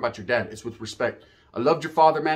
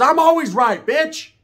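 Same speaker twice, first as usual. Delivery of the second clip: mock boast; 10 semitones higher, 5 dB louder, slower than usual. Mimics cousin's boast.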